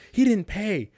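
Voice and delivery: high, loud, shocked; alarmed staff. Retold or imitated. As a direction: blurt it out as if caught off guard. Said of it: "She's like".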